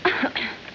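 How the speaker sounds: little put-on cough; fake cough. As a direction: cough as if losing your voice. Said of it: "severe case of laryngitis".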